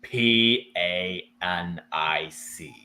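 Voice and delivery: each letter slow and low; spelled out slowly. Acted. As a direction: drag out the letters one by one.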